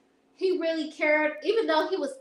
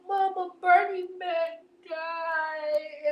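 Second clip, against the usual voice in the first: slow, higher mock-tearful wail; retold crying; 5 semitones higher, much slower than usual. Telling mom the news, slow mock-tearful. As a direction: whimper it out slowly like a crying kid. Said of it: "I was crying".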